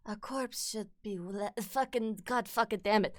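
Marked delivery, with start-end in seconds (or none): 0.1-1.5 softer put-on character voice; Lady Maria. Streamer retrying a game line and breaking off, soft character voice. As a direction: softly begin the line in character. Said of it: "when I try to do Lady Maria".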